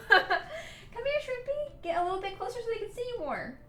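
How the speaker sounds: high laughing coo to the cat; quick high coaxing call to the pet. Real, not acted.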